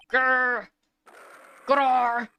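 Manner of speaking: loud mock tiger growl. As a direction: growl at them like a tiger about to pounce.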